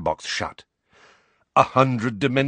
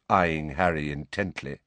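ordinary delivery

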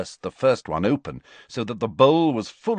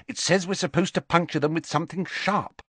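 high-pitched, flustered explanation; hurried; 8 semitones higher than usual. A narrator's reading. Flustered girl reading out instructions, hurried. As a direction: hurry on in a high voice. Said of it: "said Hermione hurriedly".

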